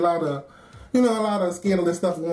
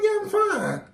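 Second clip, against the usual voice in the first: fake fine; 12 semitones higher, slower than usual. Brushing off coworker's concern.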